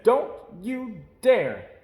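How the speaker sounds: slow, loud, stern warning; a sister forbidding her brother. A narrator's reading. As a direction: draw it out, firm and forbidding. Sister stops brother going off.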